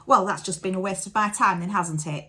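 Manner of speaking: loud, indignant mock scolding; loud retold reprimand; telling someone off. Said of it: "Proper told me off".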